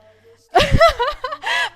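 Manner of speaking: high, loud burst of laughter; real laugh, shrieked high and loud. Real, not acted. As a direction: crack up at your own line. Laughing at own joke.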